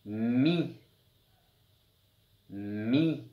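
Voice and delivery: "Mi" with a long hummed m; drawn-out sounds. Shown as a demonstration. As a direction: hum the m before the i of "Mi". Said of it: "il faut bien écouter le son des lettres".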